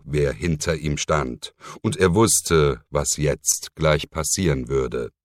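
ordinary delivery

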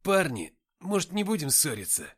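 high, pleading voice; plaintive plea. A narrator's reading. Nikita asking the guys not to quarrel, plaintive plea. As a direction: beg them pitifully to stop. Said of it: "жалобно попросил Никита".

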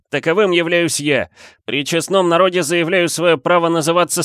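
public proclamation. A narrator's reading. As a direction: proclaim it formally to all the people. New leader addresses the crowd. (торжественное обращение к народу)

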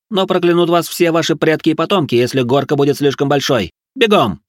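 high, manic drill orders; frenzied commanding chef; crazed cooking instructor. A narrator's reading.

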